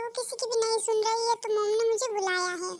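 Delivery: ordinary delivery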